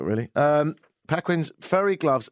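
ordinary delivery

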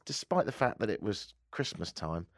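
normal talking voice

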